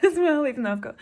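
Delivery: a loud self-conscious laugh through the words. Real, not acted. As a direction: laughs off admitting loneliness. Laughs while admitting loneliness.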